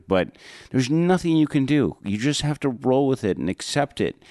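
ordinary delivery

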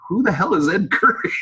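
high-pitched mock bewilderment, laugh behind it; comically clueless question; baffled retort. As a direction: play an incredulous dad, amused. Dad's baffled reply, acted.

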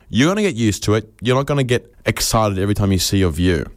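usual speaking voice

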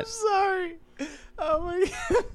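high, helpless laughter through the words; laughing apology. Real, not acted. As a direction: apologize while cracking up. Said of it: "You're fucking laughing".

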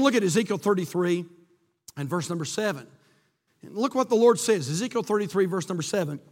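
usual speaking voice

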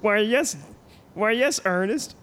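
put-on high nasal man-child; prissy high-pitched host; Pee-wee Herman voice. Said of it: "man of a thousand voices".

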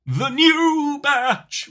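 very high, very slow, loud announcer; dramatic trailer voice. Pretend. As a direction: ham it up like a movie trailer. Hammed-up Gremlins movie voice.

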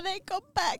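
high, plaintive re-enacted plea; mock dismay. Friend who missed a goodbye visit, mock upset pleading. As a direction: play up missing them, pitched high. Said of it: "Blev jätteledsen".

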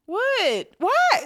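high, rising outraged what; indignant surprise. Real, not acted. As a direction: protest in disbelief. Wife indignant at being called out.

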